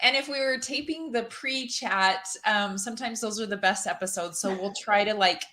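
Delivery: ordinary delivery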